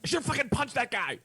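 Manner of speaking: high, loud burst of regret; mock outburst. Man waking at night, still haunted, high exasperated yell. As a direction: blurt it out high and hard, exasperated.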